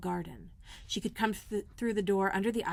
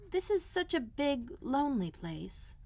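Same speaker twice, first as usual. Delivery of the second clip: drawn out and slow, a little higher; slowly; 6 semitones higher, slower than usual. A narrator's reading. Mary hiding the garden from Martha, speaking slowly. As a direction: stretch the words, thinking carefully. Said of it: "Mary said slowly".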